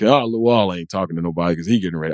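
low, fast mock player voice; imitated player chatter. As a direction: mutter it low and quick like a player. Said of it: "they're like".

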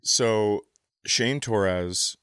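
normal talking voice

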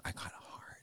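soft embarrassed whisper. Real, not acted. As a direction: confess it under your breath.

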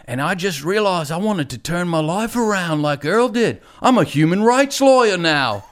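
earnest Aussie testimonial, hammed up; terrible Australian accent. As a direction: confess it like a devoted fan in a bad Australian accent. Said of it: "I'm gonna do a terrible Australian accent".

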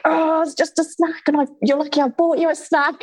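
high, snippy mock scolding of an ungrateful child; exasperated parent. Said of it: "and all that kind of dialogue".

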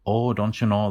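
Minnesotan with O's far back in the mouth; cliché Minnesota accent. Acted. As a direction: play the stock Minnesotan, long back O's. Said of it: "that's part of the stereotype".

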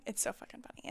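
breathless whisper, amused; whispered aside, stifling a laugh. Real, not acted. Laughing at the memory.

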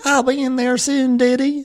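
high, sweet Southern belle voice; mocking Southern belle. Imitating the character cooing to her daddy, in a high sweet voice. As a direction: coo it up high like a sweet daughter. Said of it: "She keeps calling him Diddy".

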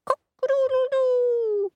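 high crowing cock-a-doodle-doo; rooster crow. A narrator's reading. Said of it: "says Cockerel".

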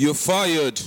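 light Trump impression, punchy; Donald Trump voice. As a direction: fire someone like a TV boss. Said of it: "Oh, that's good".

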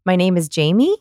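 rising question tune on "Jamie"; question inflection. Retold or imitated. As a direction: introduce yourself, lifting "Jamie" as if asking. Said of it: "and he asked his name".

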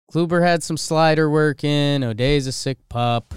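ordinary talk

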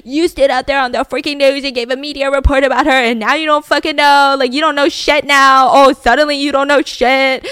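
mock-furious interrogator, climbing in volume; intense cop grilling. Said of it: "The police get more intense".

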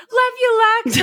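put-on high, loud drunken gushing; drunk friend. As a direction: gush affectionately like a tipsy friend. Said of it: "I fully knew Jack was drunk".

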